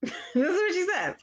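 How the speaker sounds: speaking through a high delighted laugh. Real, not acted. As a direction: laugh it out, proud. Proud laugh about daughter.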